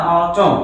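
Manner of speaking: "आटम" ending on M, no N; silent N. Shown as a demonstration. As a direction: close "आटम" on the M. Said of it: "एम के बाद एन नहीं पढ़ा जाता".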